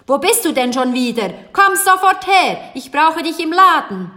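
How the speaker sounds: loud, high, impatient summons; stern shouting. A narrator's reading. Baker ordering his daughter back to the shop, shouting sternly. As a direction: bellow orders at someone out of sight. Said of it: "rief da die strenge Stimme".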